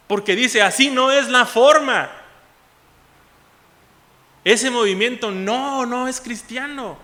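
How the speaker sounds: loud fervent sermon delivery; preaching. Acted. Pastor stirring his congregation with the story, loud preaching. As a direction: preach it with rising fervor.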